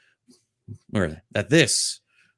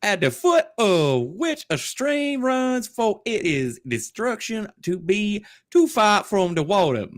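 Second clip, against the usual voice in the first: put-on voice; 4 dB louder than usual. High put-on reading voice.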